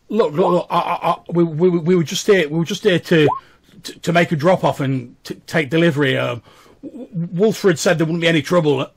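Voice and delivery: frightened common man making excuses; scared stammering. Acted. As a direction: trip over every word in fear. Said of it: "stammering. He's obviously, like, very scared".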